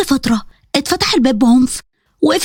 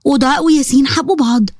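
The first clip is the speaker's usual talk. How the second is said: usual speaking voice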